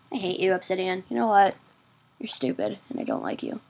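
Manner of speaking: low, soft, sinking grumble; quiet sulky grudge. Pretend. Gamer telling off the obsidian, in a quiet mock sulk. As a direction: let it sink away, low and sulky.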